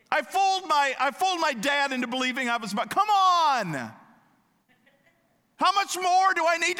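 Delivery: fed-up plea, voice way up and loud; frustrated shout. Retold or imitated. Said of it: "instead of getting frustrated and saying".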